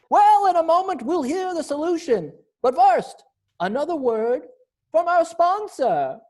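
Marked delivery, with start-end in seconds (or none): none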